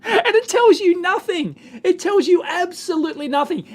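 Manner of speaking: high, incredulous complaint through laughter; laughing exasperation. Real, not acted. Vlogger fed up with an uninformative video, laughing exasperation. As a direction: protest loudly, half laughing in disbelief.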